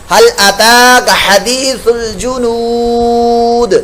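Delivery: slow melodic tajweed chant; slow Quran chant; Quran recitation. Shown as a demonstration. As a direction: chant each word long and slow. Teacher recites the verse.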